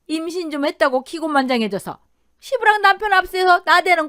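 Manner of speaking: high, loud, furious tirade; raging mother-in-law shouting abuse; yelling in rage. Acted. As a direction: rant at her at full voice. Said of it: "이를 드러내며 소리를 질렀다더군요".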